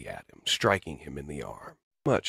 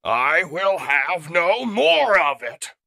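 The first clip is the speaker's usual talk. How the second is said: a loud, slow, raised roar of fury; bellowing; 13 semitones higher, 10 dB louder, slower than usual. Acted. Robot leader roaring as he rips his arm off. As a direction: drag each word out in rage. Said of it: "he bellows".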